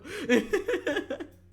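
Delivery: high, loud giggling burst; real laughter. Real, not acted. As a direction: laugh at your own joke. Laughs at own joke.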